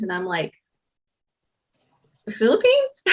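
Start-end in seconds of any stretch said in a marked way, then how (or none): 2.2-3.1 high squeaky disbelief breaking into a laugh; much higher, amazed laugh; incredulous laughing. Retold or imitated. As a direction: replay the shock, then laugh it off. Retold baffled reaction to uncle.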